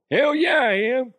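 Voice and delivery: loud, high cowboy whoop; hammed-up cowboy cheer; Cowboy DeHuff. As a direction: holler it like a comic cowboy. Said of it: "Then there's Cowboy DeHuff".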